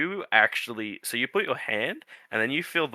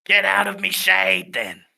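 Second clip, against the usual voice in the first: higher put-on voice with rough accent; grumpy tree; 6 semitones higher, slower than usual. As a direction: shoo them off as a grumpy old tree.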